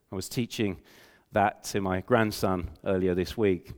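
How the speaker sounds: usual speaking voice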